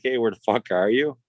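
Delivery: usual speaking voice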